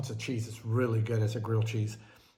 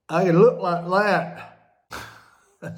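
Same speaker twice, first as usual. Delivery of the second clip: mock vain; 5 semitones higher, 7 dB louder, much slower than usual. Imagines Botox look.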